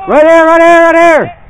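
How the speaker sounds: loud, high, urgent pointing out; urgent callout. Real, not acted. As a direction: shout it to the squad mid-fight. Callout under fire in a shootout.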